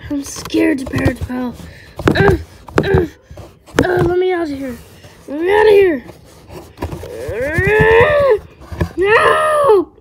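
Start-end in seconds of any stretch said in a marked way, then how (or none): 0.9-1.5 whispered, frightened; scared whisper. Acted. 2.1-9.9 high, loud begging into a scream; trapped in the coffin, begging; desperate pleading. Acted.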